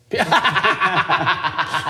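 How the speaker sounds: high burst of real laughter. Real, not acted. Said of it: "You got me, too".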